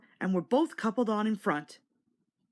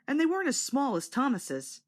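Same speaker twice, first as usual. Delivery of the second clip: plain reading voice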